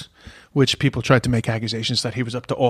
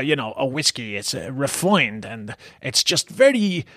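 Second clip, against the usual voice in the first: usual speaking voice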